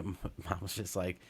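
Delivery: normal talking voice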